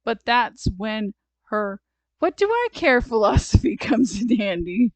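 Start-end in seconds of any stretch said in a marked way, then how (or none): 2.2-3.0 high, loud dismissive outburst; defiant. A narrator's reading. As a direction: toss it out like a feisty kid. Storybook character's defiant exclamation.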